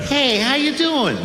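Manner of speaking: very high, slow welcoming voice; high, slow, friendly lion voice; friendly African lions. Retold or imitated. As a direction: greet the newcomer sweetly and high. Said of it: "They're like".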